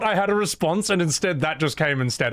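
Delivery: normal talking voice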